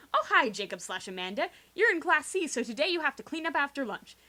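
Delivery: ordinary talk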